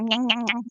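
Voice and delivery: mock dentist-drill whine; nasal buzz like a drill pulling fillings; imitating a dental drill; drill noise.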